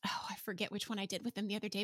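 whispered, fast aside. Real, not acted. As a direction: whisper it quickly to yourself.

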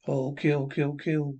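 ordinary talk

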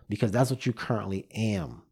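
normal talking voice

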